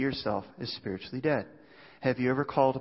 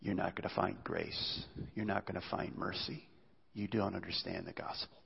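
much softer, low and intent; hushed earnest appeal; 5 semitones lower, 10 dB quieter than usual. Real, not acted. Preacher pleading with listeners about grace, hushed and low. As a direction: lean in and plead quietly.

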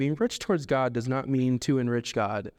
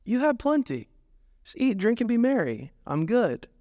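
raised, self-satisfied voice; smug rich farmer; 7 semitones higher than usual. Retold or imitated. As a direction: pat yourself on the back, smug. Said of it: "says to himself".

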